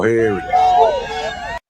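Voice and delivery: very high and very slow, stretching go; drawn-out sing-song. Acted. Vlogger hyping arrival, high stretched sing-song.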